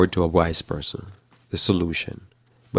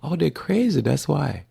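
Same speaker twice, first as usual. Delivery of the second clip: much higher "oh" of discovery; sudden realisation; 7 semitones higher than usual. Retold or imitated. Reader of the comment section, sudden realisation. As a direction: act out the penny dropping, pitched up. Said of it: "And you're like".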